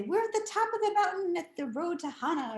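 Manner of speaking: mock excited tour; cheerful mom. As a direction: tour guide mom on the mountain.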